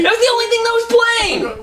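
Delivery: very high-pitched protest; squeaky, exasperated self-defense; defensive outcry. Real, not acted. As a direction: plead your case, voice leaping way up. Protesting to friend's amazed teasing.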